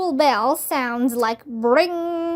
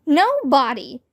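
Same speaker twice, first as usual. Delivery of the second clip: ordinary delivery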